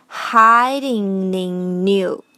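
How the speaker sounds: "hiding in you" as one slow chain; stretched, joined-up "hiding in you"; all three linked. Shown as a demonstration. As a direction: glide through "hiding in you" as hidin-nin-new. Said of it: "三个连在一起就是".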